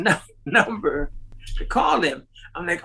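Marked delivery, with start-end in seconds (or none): none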